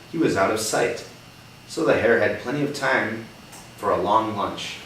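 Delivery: put-on reading a picture book to a child; gentle read-aloud for a young listener; story reading. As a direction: tell it like a storybook.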